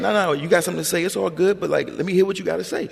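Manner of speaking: coaxing him to say it, a mother's playful dare; mother daring him to speak. Retold or imitated. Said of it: "And she'd be like".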